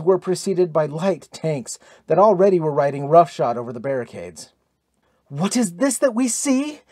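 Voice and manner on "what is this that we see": high-pitched, stunned question; astonished, voice lifted high; stunned exclamation. A narrator's reading. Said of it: "exclaimed Brynhild again, seeming stunned".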